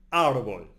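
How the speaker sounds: "Árbol" with a loud opening A, as a model to repeat; stress on first syllable. Shown as a demonstration. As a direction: punch the A of "Árbol", let the end drop. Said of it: "repeat the words after me".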